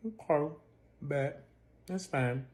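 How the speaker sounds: soft, terse resignation; low-key, pause-broken acceptance; retold curt acceptance. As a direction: let it drop quietly. Terse retold acceptance after quarrel.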